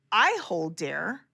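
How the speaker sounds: normal talking voice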